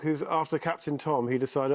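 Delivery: ordinary talk, nothing marked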